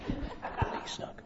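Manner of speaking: a real hushed whisper, almost no voice; secretive whisper with a stifled laugh. Real, not acted. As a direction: lean in and breathe the name. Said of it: "I'll whisper to you who it was".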